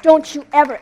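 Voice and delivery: loud, high burst of rebuke; fierce, authoritative outburst; shouted warning. Retold or imitated. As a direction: shout a sharp command. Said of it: "I'm shouting at him".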